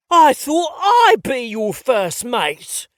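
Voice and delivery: loud, slow, mock-offended English pirate accent; outraged pirate in bad English accent; hammy English pirate accent. Pretending to be betrayed during play, hammy English accent. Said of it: "my horrible English accent".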